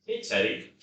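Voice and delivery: ordinary delivery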